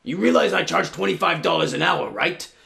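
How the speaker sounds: wolf voice. Acted. Wolf demands payment.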